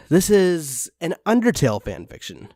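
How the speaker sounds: ordinary talk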